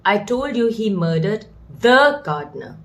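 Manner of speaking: "the" isolated and hit hard before "gardener"; stress on THE. Shown as a demonstration. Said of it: "so we'll stress on the now".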